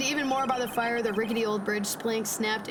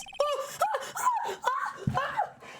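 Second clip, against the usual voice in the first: high shouted burst of laughter; shrieking laugh. Real, not acted. Shocked by game revival.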